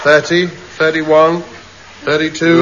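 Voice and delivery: slow, drawn-out counting; slow deliberate counting. Acted. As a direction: spell each number out slowly. Recounts slowly after interruption.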